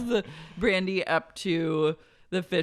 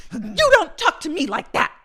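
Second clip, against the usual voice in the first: high, loud indignant shriek; imitating Vicky telling off Brandy; mock Vicky; 11 semitones higher, 9 dB louder than usual. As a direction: scold like an outraged housewife. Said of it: "Vicky goes".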